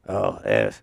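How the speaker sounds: lightly voiced older smoker, dropping low on the opening; a hint of the worn-out date, offhand; mock smoker voice. Retelling his date's excuse for the trip, a light smoker voice. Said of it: "she sounded like a sixty-year-old smoker".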